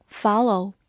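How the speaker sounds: "follow" with an American open "ah" first vowel; American pronunciation. Shown as a demonstration. Teacher showing learners the US variant, American pronunciation of "follow". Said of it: "美式发音".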